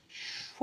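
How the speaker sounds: a soft hissed gust of storm wind; wind whoosh. Retold or imitated. Rabbi imitating the storm wind passing Eliyahu, soft voiceless whoosh. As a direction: blow it out like a rushing hurricane. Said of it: "like a hurricane".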